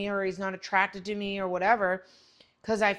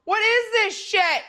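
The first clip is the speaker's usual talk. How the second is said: baffled yell; 12 semitones higher, 7 dB louder, slower than usual. Retold or imitated. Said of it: "I'm like".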